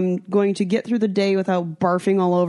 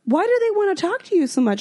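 baffled; 12 semitones higher than usual. Retold or imitated. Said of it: "And I've".

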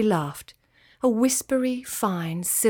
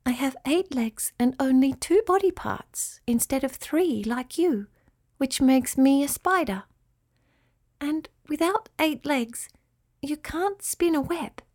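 higher, patient spider voice; kindly spider Nafila, explaining; 6 semitones higher than usual. Acted. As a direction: explain gently to a small pupil.

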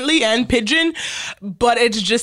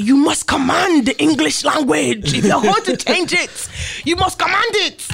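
imitating dad, higher and commanding; dad's decree; 6 semitones higher than usual. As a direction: lay down the law as an imitated dad. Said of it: "what my dad was saying when he would say that".

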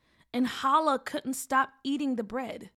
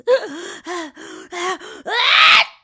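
big loud sneeze; 7 semitones higher, 14 dB louder than usual. Acted. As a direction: sneeze hard. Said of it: "She sneezed".

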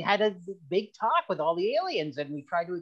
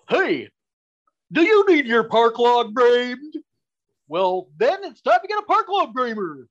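loud, high ad announcer pitch; alien commercial voice; 5 semitones higher, 7 dB louder than usual. Acted. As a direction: sell it like a hyped TV ad. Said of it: "we hear alien commercials".

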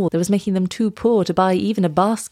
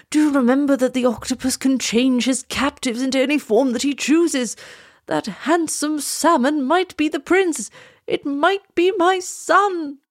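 high, alarmed king; horrified cry; 7 semitones higher than usual. A narrator's reading. King fears the salmon is his son, horrified. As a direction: cry out in horror, slowing toward the end. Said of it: "cried the king in a terrible voice".

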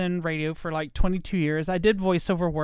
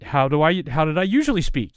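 ordinary talk, nothing marked

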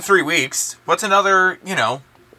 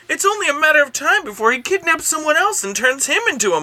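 higher, lightly voiced detective; 7 semitones higher than usual. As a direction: play the detective, pitched up. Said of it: "I'm just gonna go for it again".

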